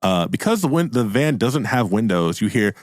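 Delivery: usual speaking voice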